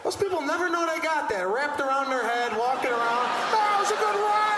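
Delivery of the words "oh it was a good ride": high, loud mock-excited yell; oblivious thrilled rider. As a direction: shout it like a clueless, delighted swimmer. Mimics oblivious rider after the slide.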